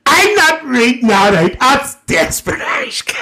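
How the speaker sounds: mock shock. Real, not acted. Shocked at a rude comment.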